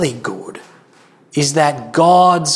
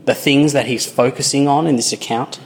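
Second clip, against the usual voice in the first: ordinary talk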